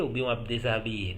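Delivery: normal talking voice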